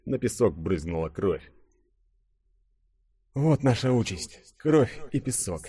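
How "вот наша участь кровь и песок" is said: higher, slow-starting inner despair; lightly acted hopeless inner voice; despairing thought. A narrator's reading. Denis watches the gladiator die, thinking in mild despair. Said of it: "с отчаянием подумал Денис".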